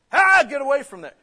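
sudden high, loud parental yell; shouted warning. Acted. Parent to a child near the fireplace, shouted warning. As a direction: shout as if pulling a child from danger. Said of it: "Yeah, you warn them".

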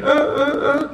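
high, jeering "uh, uh, uh"; mocking laugh. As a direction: imitate a taunting laugh.

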